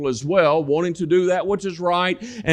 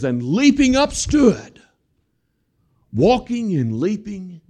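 joyful exclamation; 4 semitones higher than usual. Acted. Preacher acts out healed beggar.

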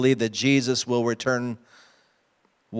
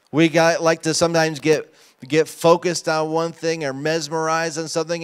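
usual speaking voice

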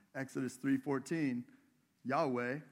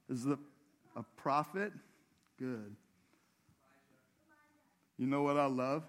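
ordinary delivery

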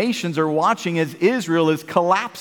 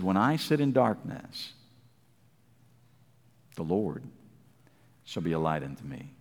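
very low and soft, fading to near nothing; hushed scripture; 10 dB quieter than usual. Acted. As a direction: read it like a secret comfort. Verse read hushed.

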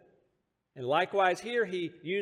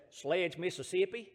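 put-on southern accent; 4 dB quieter than usual. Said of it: "with a more southern accent than mine".

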